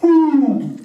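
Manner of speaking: put-on high little baby whimper. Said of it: "a little baby whimper".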